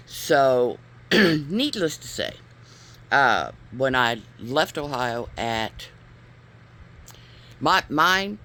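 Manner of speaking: usual speaking voice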